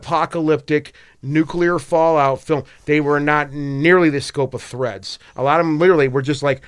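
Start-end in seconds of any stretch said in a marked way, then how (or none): none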